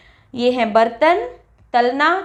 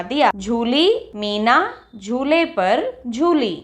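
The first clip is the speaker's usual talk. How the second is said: slow dictation; slower than usual. Shown as a demonstration. Reads example sentences aloud.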